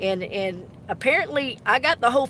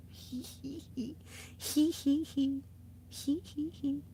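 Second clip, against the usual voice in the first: 1 mock giggle; 4 semitones higher, 10 dB quieter than usual. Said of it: "I can see her laughing".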